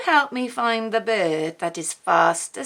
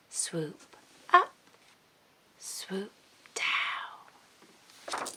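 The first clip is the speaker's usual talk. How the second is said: hushed swooping; 7 dB quieter, slower than usual. Acted. Reading to small children.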